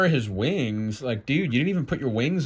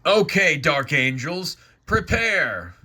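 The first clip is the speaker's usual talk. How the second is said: loud, raised rallying order; commanding Gabe; 4 semitones higher, 3 dB louder than usual. Acted. Gabe rallies the dark angels, commanding. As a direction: call the troops to readiness.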